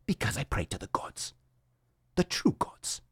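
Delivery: whispered, secretive mock devotion; hushed mock-pious whisper; Viking whisper. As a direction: breathe it like a zealot sharing a secret. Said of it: "All right, enough of that".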